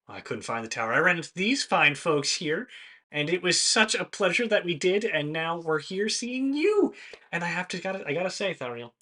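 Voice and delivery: lightly voiced sunny robot Ted; cheerful Ted voice. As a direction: beam with innocent friendliness. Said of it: "Ted will go".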